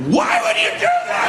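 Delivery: exasperated outburst. Retold or imitated. Exasperated reply to mom's article.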